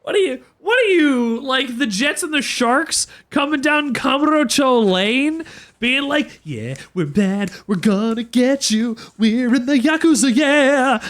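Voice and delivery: high, hammed-up street punk swagger; mock them as posturing gangsters; a hint of a bratty wannabe thug; mock tough gang talk.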